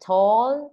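"Tall" high and loud, holding the final L; clear final l. Shown as a demonstration. Teacher drills the final L of tall for the class. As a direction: keep the L at the end of "Tall". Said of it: "âm L để như thế".

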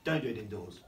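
ordinary delivery, nothing marked

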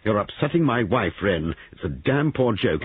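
an irritated husband's rebuke; angry. A narrator's reading. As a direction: tell him off, annoyed. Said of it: "Giles said angrily".

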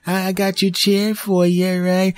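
high, slow, sugary fake-nice kid; sneaky kid voice. Bad kid offering the chair, high fake-nice voice. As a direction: play the scheming kid being sweet. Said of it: "That's the kind of kid that goes".

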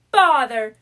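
cried in annoyance. A narrator's reading. Mouse finding no matches, loud and annoyed. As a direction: bark out the frustration. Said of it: "cried M".

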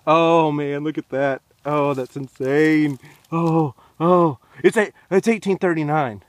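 loud, high cries of discovery; thrilled amazement. Real, not acted. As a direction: burst out, astonished at the date. Discovers the date on coin.

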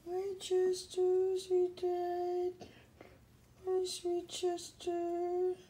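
high, sustained singsong coo; doting pet-talk. Real, not acted. Owner cooing to lazy pet Chester, high doting voice. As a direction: coo sweetly to a sleepy pet.

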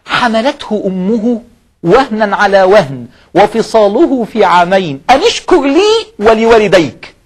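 very slow, rising recital of the verse; slow building recital; Quran recitation. Acted.

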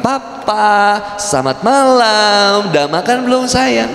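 sweet, high, slow sing-song greeting; a wife welcoming her husband home, sugary and high like a kindergarten teacher. Retold or imitated. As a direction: greet papa like a cheerful TK teacher greets kids. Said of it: "seperti sebagaimana guru T K".

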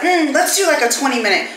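confident past self. Retold or imitated. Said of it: "the first time I was like".

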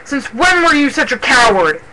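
heated, scornful outburst; spat in anger. A narrator's reading. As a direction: indignantly order him to let go. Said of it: "spat Mothpelt".

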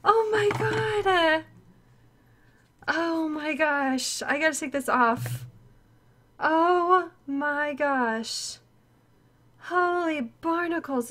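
slow, soft, overwhelmed wonder; hushed awe. Real, not acted. Streamer taking in the beautiful gift, hushed awe. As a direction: let each word sink in, softly.

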